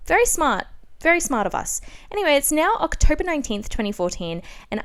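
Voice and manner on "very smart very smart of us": dry mock praise, pitched up; sarcastic very smart. Real, not acted. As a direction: say it with heavy irony. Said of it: "sarcastic comment on own diary".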